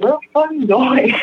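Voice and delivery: high, mock yell after someone; retold yell. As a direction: call after her in disbelief. Boyfriend yelling after her from driveway.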